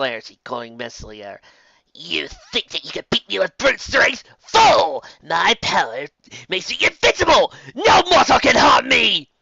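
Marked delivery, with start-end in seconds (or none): 1.9-4.2 hissed villain whisper; evil enchantress whisper; menacing whisper. A narrator's reading. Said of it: "said Torka". 4.4-9.3 high, loud, slow roar; raging enchantress boast; furious villain shout. A narrator's reading. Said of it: "said Torka".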